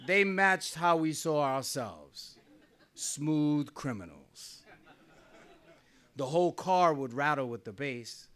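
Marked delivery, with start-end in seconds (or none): none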